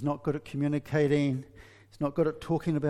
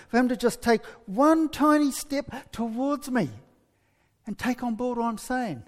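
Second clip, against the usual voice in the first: frustrated wife; 8 semitones higher than usual. Retold or imitated. Preacher voicing the wife longing for him to talk. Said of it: "she's thinking".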